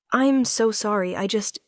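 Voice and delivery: plain reading voice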